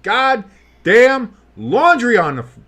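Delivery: high, loud big-play call; excited play-by-play. Real, not acted. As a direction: belt out the first down. Live call of big catch, flag.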